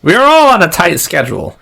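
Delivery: very high-pitched, weird official voice; strange, high gatekeeper voice; weird attendant. Acted. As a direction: pipe up high like an odd dockside attendant. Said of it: "they sound weird".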